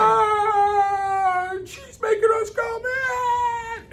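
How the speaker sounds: mock bawling, very high and drawn out; bratty wailing tantrum. Bratty kid throwing himself on the lawn, mock wailing. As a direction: screech it like a sulking child crying. Imitating own childhood tantrum.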